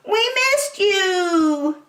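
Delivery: high, slow, drawn-out reunion call; stretched high-pitched greeting to a missed friend; joyful cry. A narrator's reading. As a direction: cry out happily in high duckling voices. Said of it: "they all cried".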